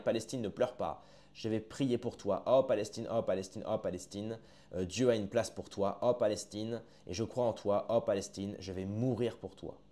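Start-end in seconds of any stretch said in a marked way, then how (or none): none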